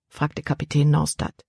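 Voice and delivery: ordinary delivery, nothing marked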